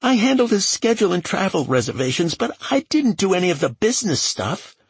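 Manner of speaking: wailing self-defence at a quick clip; frightened assistant protesting. A narrator's reading. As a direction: rush out a high-pitched defence.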